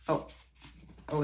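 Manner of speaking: ordinary talk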